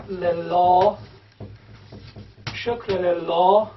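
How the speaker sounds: le la stretched out slowly; slow syllables. Shown as a demonstration. Teacher sounding out the verse for students. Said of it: "write this in pronunciation".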